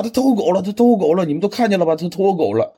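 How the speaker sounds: Superman loses it in front of everyone; flustered, shrill-free yelling, hammed-up; freaking out. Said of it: "他就在这自己抓狂起来了啊".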